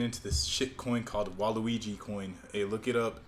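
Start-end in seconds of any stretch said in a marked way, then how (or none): none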